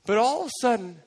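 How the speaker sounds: ordinary delivery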